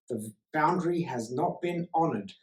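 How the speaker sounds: ordinary talk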